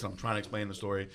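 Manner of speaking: normal talking voice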